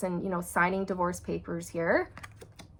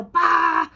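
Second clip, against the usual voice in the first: a sudden loud burst sound, much higher; pent-up anger finally exploding; loud explosive bah; 8 semitones higher, 10 dB louder than usual. Retold or imitated. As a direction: mimic something bursting open.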